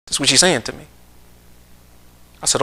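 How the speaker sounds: normal talking voice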